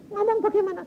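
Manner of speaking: very high, whiny mock bewilderment; squeaky retold protest; acted helpless question. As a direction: imitate a flustered husband. Retold husband helplessly asks.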